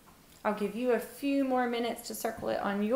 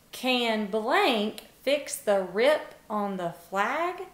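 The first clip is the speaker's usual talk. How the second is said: slow reading aloud; slower than usual. Shown as a demonstration. Teacher slowly reads the sentence to her class. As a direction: read slowly, word by word. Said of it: "So number one says".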